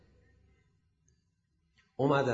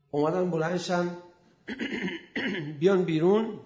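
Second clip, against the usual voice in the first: ordinary talk, nothing marked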